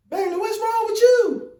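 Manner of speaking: high, loud mother calling out; mama yelling from the doorway; worried mama. Retold or imitated. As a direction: call out like a worried mom. Mama asks what's wrong.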